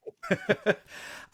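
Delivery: real high-pitched giggle; tickled, genuine laughter; amused laugh. Real, not acted. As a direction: burst into high laughter at a joke. Amused by the Eeyore remark.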